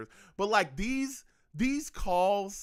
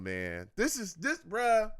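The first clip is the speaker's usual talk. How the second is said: ordinary delivery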